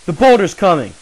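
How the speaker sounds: loud, high strained urging; straining shout. Acted. Villager holding the boulder, shouting at the boys to run. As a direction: push through the strain and urge them on.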